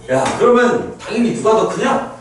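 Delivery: ordinary delivery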